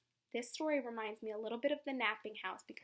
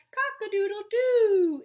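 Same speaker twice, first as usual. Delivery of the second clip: high, loud crowing; rooster crow; 10 semitones higher, 14 dB louder, slower than usual. Acted. The flustered fowl crows, high and loud. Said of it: "who cried".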